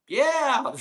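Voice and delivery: high, loud, strained "Yeah."; a startled, forced "Yeah." to go along; awkward mock yeah. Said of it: "And I'm like".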